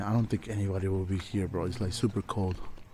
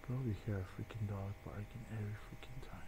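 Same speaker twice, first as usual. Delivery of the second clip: whispering; 14 dB quieter than usual. Real, not acted. Complaining hushed during recording.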